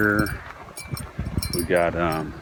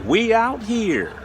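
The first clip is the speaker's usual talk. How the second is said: excited call; 11 semitones higher than usual. Real, not acted. Catchphrase called out high.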